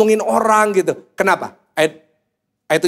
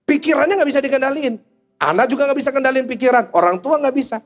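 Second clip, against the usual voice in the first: preaching at full force; 7 semitones higher than usual. Acted. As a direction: drive it home to the crowd. (khotbah berapi-api, suara tinggi)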